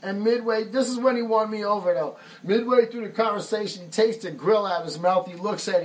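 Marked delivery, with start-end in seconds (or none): none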